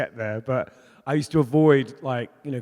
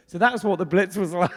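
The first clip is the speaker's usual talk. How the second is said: laughing; 6 semitones higher than usual. Real, not acted. Laughing while telling story.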